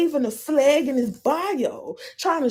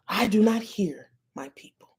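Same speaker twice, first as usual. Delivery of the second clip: whisper; 2 semitones lower than usual. Real, not acted. Judging the man's voice.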